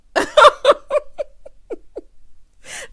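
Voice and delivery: high, loud laughing fit; big burst of high-pitched laughter; long laugh. Real, not acted. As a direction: crack up loudly, letting it run on. Amused by the retold call.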